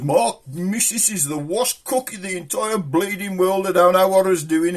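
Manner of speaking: mock yell of a fed-up neighbour in the street; half-hearted street shouting; retold yelling. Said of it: "he was yelling his head, head off".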